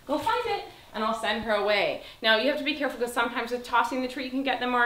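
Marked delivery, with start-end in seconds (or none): 0.1-0.7 high bright go find it; high dog cue. Real, not acted. Trainer sending her dog for the treat.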